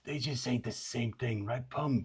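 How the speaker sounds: a lightly voiced creature, hushed and wheedling; quiet steam creature.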